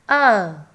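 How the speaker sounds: A said as a short clear letter sound; teacher modelling the sound A; phonic sound demo. Shown as a demonstration. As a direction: sound out the letter A slowly for kids. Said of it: "Sound of A is".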